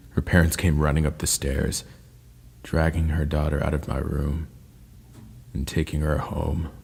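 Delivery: ordinary delivery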